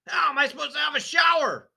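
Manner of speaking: loud, high furious shout; angry shout. A narrator's reading. Father shouting at wife and daughter, loud and furious. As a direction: roar it in outrage. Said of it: "he demanded".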